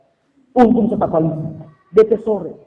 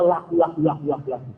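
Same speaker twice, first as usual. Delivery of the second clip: ordinary talk, nothing marked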